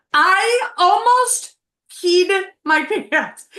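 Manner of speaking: high, slow, breaking into laughter; laughing. Real, not acted. Wife laughing at husband's joke, high and slow. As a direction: laugh through the words.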